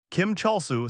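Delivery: a hint of a shout across the crowd; mild scream. A narrator's reading. A bystander calling out Cholsu's name, mildly shouted. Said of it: "someone suddenly screamed".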